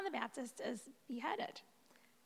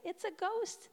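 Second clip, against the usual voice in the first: frightened cry; 9 semitones higher, 4 dB louder, slower than usual. A narrator's reading. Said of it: "cried out in fear".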